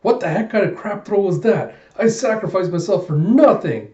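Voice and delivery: high-pitched indignant mock yell. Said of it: "just, like, yelling at Anya".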